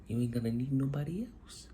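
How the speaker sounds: sudden hushed whisper, like sharing a secret; a confiding whisper to the viewer. Real, not acted. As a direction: drop to a whisper to let it land. Motivational aside to viewers.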